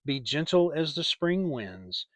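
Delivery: usual speaking voice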